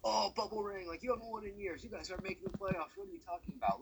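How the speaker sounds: lightly voiced jeering fans; mocking fans. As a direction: sneer it like a heckler in the comments. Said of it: "everyone's gonna say".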